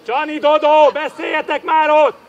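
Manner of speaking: loud touchline instruction; demanding shout. Real, not acted. Coach telling players to talk to each other, demanding shout.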